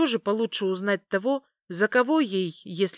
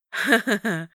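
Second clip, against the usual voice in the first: real laugh; 2 semitones higher than usual. Real, not acted. Laughs at the joke.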